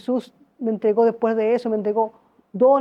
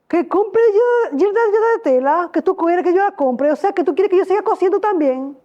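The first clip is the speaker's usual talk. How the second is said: incredulous protest; 7 semitones higher than usual. Retold or imitated.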